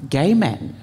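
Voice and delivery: usual speaking voice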